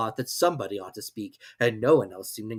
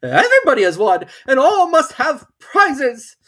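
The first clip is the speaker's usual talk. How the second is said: grand Dodo; 13 semitones higher, 13 dB louder than usual. Acted. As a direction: declare it grandly. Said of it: "At last the Dodo said".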